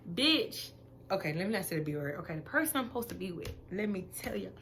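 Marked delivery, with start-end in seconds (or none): 0.1-0.7 high rising sassy bitch. Real, not acted. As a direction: toss it off with attitude. Emphatic aside in rant.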